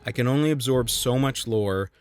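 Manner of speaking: ordinary delivery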